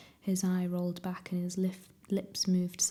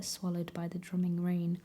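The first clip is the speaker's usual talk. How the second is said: usual speaking voice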